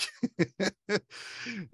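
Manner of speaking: high, amused laughing fit; helpless laugh. Real, not acted. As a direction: crack up at the impression to come. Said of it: "It just makes me so laugh".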